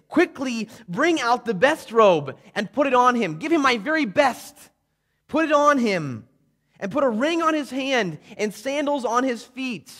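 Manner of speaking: loud, high, urgent orders; father's commands. Acted. Father ordering the slaves to fetch robe and ring, loud and high. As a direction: call out to the servants, overjoyed.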